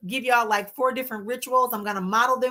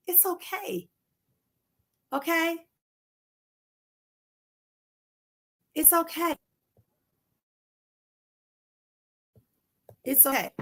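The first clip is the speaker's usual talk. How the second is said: soft and a little higher, gently soothing; soft reassurance; 5 semitones higher, 6 dB quieter than usual. Real, not acted. Coach comforting her viewers, softly reassuring. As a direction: hush them kindly, it's okay.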